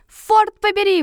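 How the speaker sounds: high, loud swearing; curse. A narrator's reading. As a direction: spit out the oath. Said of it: "выругался Гельмгольц".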